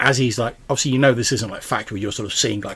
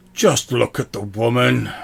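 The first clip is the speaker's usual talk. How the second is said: louder, slow exasperated sword voice; slightly exasperated; 2 semitones higher, 4 dB louder, slower than usual. Acted. Sword telling its wielder to look at the woman, exasperated. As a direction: draw it out with exasperated impatience. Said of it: "in a slightly exasperated voice".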